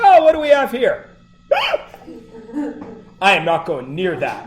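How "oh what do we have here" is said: breezy doctor. Retold or imitated. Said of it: "He said".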